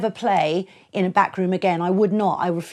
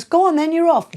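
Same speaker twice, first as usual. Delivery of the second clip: sudden go-ahead; 11 semitones higher, 6 dB louder than usual. Retold or imitated. Promoter sending her on.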